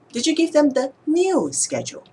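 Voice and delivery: "new" punched high and loud, then falling; stress on new. Shown as a demonstration. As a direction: ask it hitting the word "new". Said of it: "changing how I emphasize different words".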